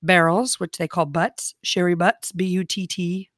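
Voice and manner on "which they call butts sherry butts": ordinary talk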